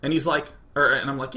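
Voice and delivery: ordinary talk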